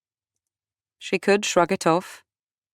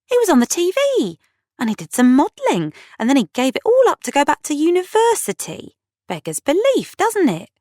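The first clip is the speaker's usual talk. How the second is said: very high, louder towards key words; protesting; 11 semitones higher, 3 dB louder than usual. A narrator's reading. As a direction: pile up reasons in high-pitched disbelief. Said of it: "Kat protested".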